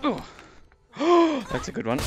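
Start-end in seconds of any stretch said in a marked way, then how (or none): 1.0-1.5 higher, audible startled intake; sharp gasp. Real, not acted. Player startled mid-fight, sharp gasp. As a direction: gasp in surprise.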